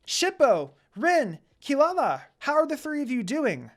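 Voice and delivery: high, loud happy greeting; exclaimed. A narrator's reading. Kagome greeting the running children, excited and loud. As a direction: greet the kids with joy. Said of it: "Kagome exclaimed".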